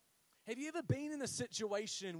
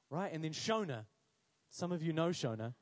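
normal talking voice

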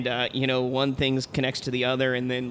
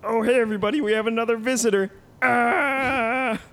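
put-on high squealing man-child greeting; excited squeaky host; Pee-wee Herman voice; 8 semitones higher, 4 dB louder than usual. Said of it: "doorbell rings".

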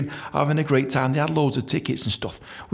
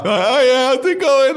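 high, loud hammed-up party greeting; over-cheery greeting; 12 semitones higher, 7 dB louder, slower than usual. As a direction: burst in way too cheerful. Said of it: "like that, right?".

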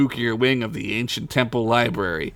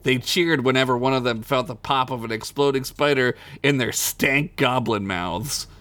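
ordinary talk

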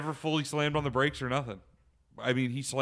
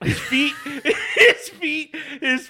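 high, loud, laughing through the words; can barely get it out for laughing; 14 semitones higher, 8 dB louder, slower than usual. Real, not acted. Telling friends about Blake's feet, laughing hard. Laughing while retelling the scare.

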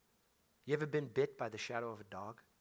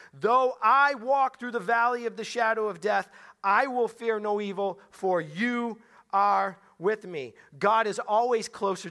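much louder and higher, slowing hard on the last line; full preaching force; 6 semitones higher, 9 dB louder than usual. Shown as a demonstration. Preacher rereading the verse to the congregation as words to God, loud and high. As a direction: proclaim it as speaking straight to God. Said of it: "now it goes from he and me".

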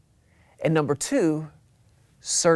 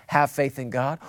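lower, softer, settled reply; calm answer; 5 semitones lower, 6 dB quieter than usual. Acted. Preacher calmly answering the panicked believer, lower and softer.

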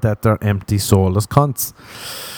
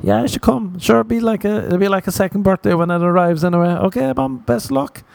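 much higher, breezy sign-off; fake cheery son; 11 semitones higher than usual. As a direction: brush mom off cheerfully, pitched way up. Pretend phone call to mom.

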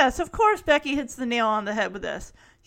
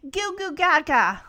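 higher, lightly voiced baby talk; imitated baby babble; 4 semitones higher, 4 dB louder than usual. Three-year-old pretending to be a baby, lightly imitated babbling. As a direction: babble like a kid playing baby.

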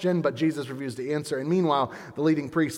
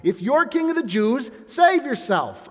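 high, slow taunting jeer; mocking; 10 semitones higher, 5 dB louder than usual. Soldiers taunting Jesus on the cross, mocking. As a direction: sneer it out slowly. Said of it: "The soldiers mocked him".

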